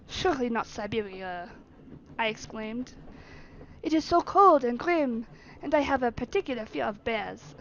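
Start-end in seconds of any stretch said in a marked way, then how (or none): 0.1-1.5 put-on French-accented protest. Servant objecting to route through Siberia, French accent. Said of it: "French accent is so much easier". 3.8-7.5 put-on emphatic French-accented complaint. Servant confessing his dread to master, French accent. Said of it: "French accent is so much easier".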